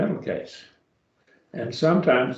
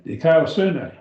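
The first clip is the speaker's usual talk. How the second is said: Dikaiosune run together quickly, pitched higher; sped up; 5 semitones higher, 4 dB louder than usual. Shown as a demonstration. Teacher showing a class the joined-up Greek word, sped up. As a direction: say Dikaiosune as one flowing word. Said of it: "then speed yourself up".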